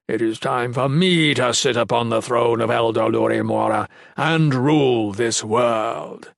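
slow, drawn-out villain proclamation; rumbling monster. A narrator's reading. Monster condemning Ulrich and claiming the throne, rumbling. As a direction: pronounce doom with monstrous relish. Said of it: "Mickig rumbled".

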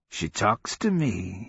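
put-on drawn-out, wandering old-man voice; mad old man. A narrator's reading. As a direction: muse aloud as if to a phantom. Mad old sergeant speaking dreamily.

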